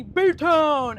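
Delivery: a loud, cheery call of the nickname; retold call-out. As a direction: shout it out like a sports fan. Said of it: "someone said to me".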